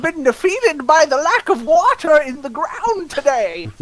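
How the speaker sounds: high, swooping lament of defeat; worm villain. Acted. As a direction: wail like a melodramatic cartoon worm. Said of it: "The regular used his worm powers".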